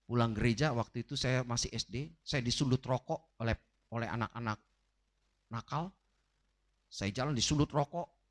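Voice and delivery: ordinary talk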